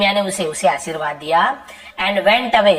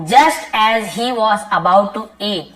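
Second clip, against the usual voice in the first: slow reading; much slower than usual. Shown as a demonstration. Teacher reads story sentence aloud.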